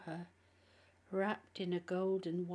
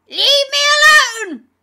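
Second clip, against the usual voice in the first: piercingly high, full-volume scream; demon's scream; 25 dB louder, faster than usual. A narrator's reading. Demon fighting off the exorcist, screaming. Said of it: "the demon screamed".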